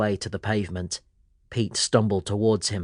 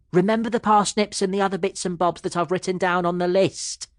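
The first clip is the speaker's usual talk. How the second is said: higher voice of Mum calling after him; 9 semitones higher than usual. A narrator's reading. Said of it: "Mum shouted after him".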